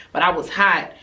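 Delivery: ordinary talk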